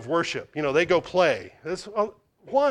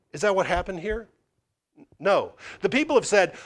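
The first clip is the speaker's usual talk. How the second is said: ordinary talk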